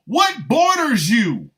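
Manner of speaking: loud, high, drawn-out disbelief; incredulous outburst. Acted. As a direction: stretch the question out in loud bafflement. Mocking an absurd border claim.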